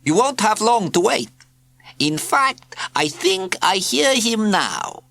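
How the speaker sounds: high, slow, smug cat purr. A narrator's reading. Cat prefect announces the postman, high slow purr. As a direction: stretch it out like a knowing cat. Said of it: "purred Zanzibar".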